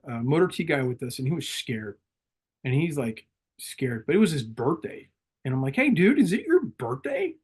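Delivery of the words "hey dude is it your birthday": upbeat cheer. Retold or imitated. Cheering up a scared Marine.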